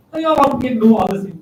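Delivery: ordinary talk, nothing marked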